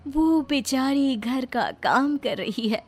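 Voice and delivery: story narration. A narrator's reading. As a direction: tell the story calmly. Narrator links scenes.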